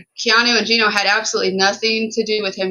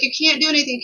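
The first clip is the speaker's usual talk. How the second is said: higher, pleading mock protest; imitating Keanu; 6 semitones higher, faster than usual. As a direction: play Keanu begging him to stop, higher. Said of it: "And Keanu was like".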